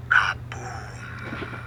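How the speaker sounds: ordinary delivery, nothing marked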